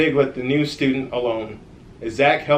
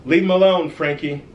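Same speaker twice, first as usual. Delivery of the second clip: firm call-out; 5 semitones higher, 5 dB louder than usual. Acted. Zach stands up to bully.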